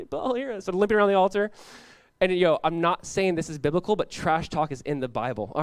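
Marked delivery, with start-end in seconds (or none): 0.1-0.6 high, feeble mock pleading; pathetic plea. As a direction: play a pitiful worshipper, pitched up and weak. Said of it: "like, pathetically".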